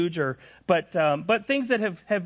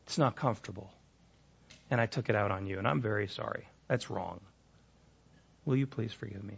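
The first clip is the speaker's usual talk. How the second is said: soft, lower, sincere retold apology; tender apology; 8 semitones lower, 5 dB quieter than usual. Husband retelling his apology to his wife, soft and sincere. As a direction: ask forgiveness gently and humbly.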